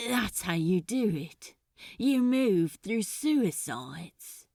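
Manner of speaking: stunned, strained delivery; nearly raw voice. A narrator's reading. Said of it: "his voice not quite raw".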